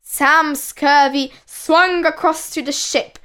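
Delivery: plain reading voice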